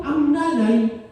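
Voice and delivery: normal talking voice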